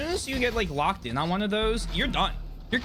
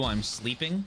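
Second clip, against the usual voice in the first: ordinary delivery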